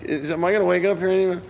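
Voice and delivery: overwhelmed fan pinching himself; disbelieving excitement. Retold or imitated. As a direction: session player in the headphones, giddy disbelief. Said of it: "I'm going".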